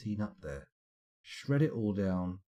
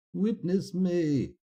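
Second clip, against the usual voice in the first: dramatic battle cry; 9 semitones higher, much slower than usual. Acted. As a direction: shout it like a war cry. Dramatic movie quote.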